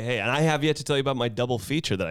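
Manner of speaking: rushed, tumbling words; pressured rushing speech. Real, not acted. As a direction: hurry through it, words spilling out. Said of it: "I've been talking a mile a minute".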